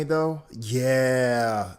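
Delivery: usual speaking voice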